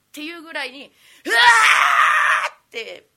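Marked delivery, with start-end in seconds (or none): 1.2-2.5 slow loud drawn-out wow; crowd roar. Retold or imitated. Imitating a roaring audience.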